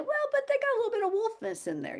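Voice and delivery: very high, soft sugary caution; high, gentle mock-sweet hint; sweet warning. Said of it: "But I gotta tell you".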